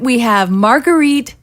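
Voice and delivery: ordinary talk, nothing marked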